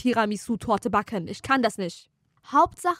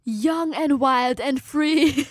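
slow mock solemn; 3 semitones higher, 4 dB louder, much slower than usual. Mock pathos closing phrase.

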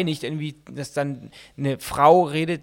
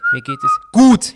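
GUT punched high and loud; coded plea; 13 dB louder than usual. Pretend. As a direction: pretend all is well, hitting gut hard. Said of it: "Das ist ein Code". (verschlüsselter Hilferuf mit lautem „gut“)

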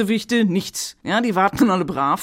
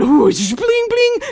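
sparkly bling sounds; 14 semitones higher, 4 dB louder, much slower than usual. Pretend. Sparkle sound effect imitation.

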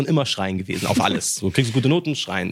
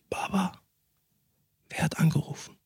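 hushed question; 8 semitones higher, 8 dB quieter than usual. Retold or imitated. Waking father at 5 a.m.

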